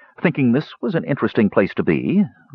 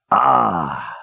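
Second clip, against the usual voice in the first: breathed out. Acted. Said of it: "he breathed".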